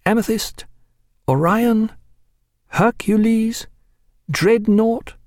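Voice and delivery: intoned like a litany at the altar; solemn altar tone. A narrator's reading. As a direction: chant the names reverently. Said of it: "a tone of voice more usual at the altar".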